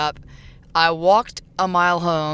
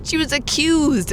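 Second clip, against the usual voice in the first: mock correction; 9 semitones higher, slower than usual.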